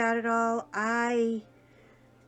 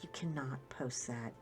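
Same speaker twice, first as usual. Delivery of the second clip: whispered "You cannot post that" low and urgent; fierce low whisper, lower in pitch; hissed whisper; 7 semitones lower, 11 dB quieter than usual. Retold or imitated. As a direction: whisper the warning at your husband. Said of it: "and I'm like".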